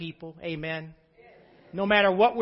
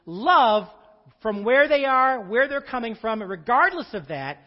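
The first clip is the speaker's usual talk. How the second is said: louder, slower, hammering the word love; full-force preaching; 8 dB louder than usual. Real, not acted. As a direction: preach it out to the congregation.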